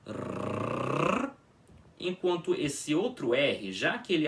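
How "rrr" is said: rrr rolled on the tongue; trilled R sound. Shown as a demonstration. Teacher demonstrating the initial R trill. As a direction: roll out a long trilled rrr. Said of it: "fazendo uma pronúncia assim".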